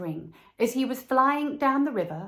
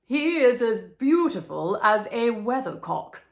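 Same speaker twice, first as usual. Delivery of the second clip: lightly voiced pompous councillor, a touch louder; councillor's praise; 6 dB louder than usual. Town councillor admiring the statue, mildly pompous. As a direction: show off artistic taste with grand praise.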